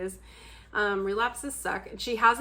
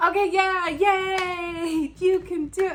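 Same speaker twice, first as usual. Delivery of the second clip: high, louder cheer cracking into laughter; laughing mock cheer; 10 semitones higher, 7 dB louder, much slower than usual. Cheering a friend who keeps relapsing, laughing. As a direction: cheer while giggling at yourself.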